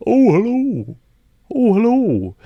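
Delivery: high, loud sing-song hello; hooting hello to someone across the forest; calling greeting. Acted. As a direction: call it out musically, drawn out. Acting out a far-off greeting.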